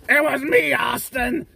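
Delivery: high, loud hammed-up confession; dramatic reveal. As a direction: shout the reveal like a villain. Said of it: "Let me do it".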